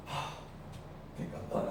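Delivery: slow, hushed and spent; slow worn-out whisper; tired whisper. Retold or imitated. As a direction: whisper as if out of gas. Opponent tiring, quoted.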